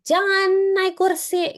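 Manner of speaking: high, loud, slow parental yell; scolding shout. Retold or imitated. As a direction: bark the order at the child like a stern parent. Parent scolding child on chair. (membentak anak dengan keras dan lambat)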